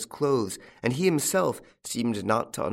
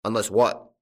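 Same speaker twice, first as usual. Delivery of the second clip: loud, abrupt query; echoed question; 5 dB louder than usual. A narrator's reading. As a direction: demand the rest sharply. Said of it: "echoed the farmer".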